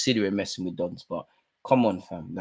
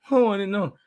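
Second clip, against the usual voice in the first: mock innocent footballer; 9 semitones higher, 3 dB louder than usual. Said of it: "footballers committing obvious fouls and going".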